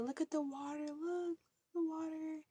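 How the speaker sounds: mother calming daughter, high soft soothing. Retold or imitated. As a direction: gently coax her to look. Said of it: "trying to calm her down".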